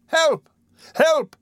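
very high-pitched, short cries; boy crying for help. A narrator's reading. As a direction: cry out high, struggling in a grip. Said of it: "cried Oliver, struggling".

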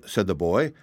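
ordinary delivery

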